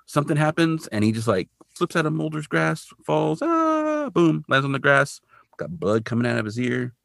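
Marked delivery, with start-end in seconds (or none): 3.4-4.1 high, thin "aah" of someone falling; little high-pitched falling wail; mock falling scream. Imitates man falling to his death.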